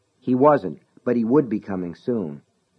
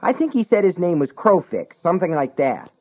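irritated; 8 semitones higher, 3 dB louder than usual. Acted. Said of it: "sounded irritated".

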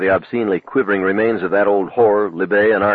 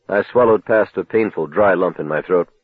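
usual speaking voice

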